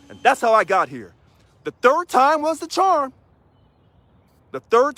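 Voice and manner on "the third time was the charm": higher, slow and triumphant; high, slow victory line; emphatic high. Shown as a demonstration. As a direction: savor the win, pitched up. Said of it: "repeated line".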